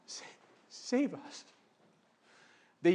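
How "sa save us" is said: quiet plea. Shown as a demonstration. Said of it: "it's a request".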